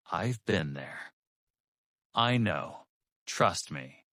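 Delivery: short phrases with pauses, dropping at the end; seasoned advice, given with weight; slow, heavy rhythm. Shown as a demonstration. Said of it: "This rhythm says".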